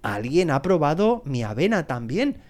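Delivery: ordinary delivery, nothing marked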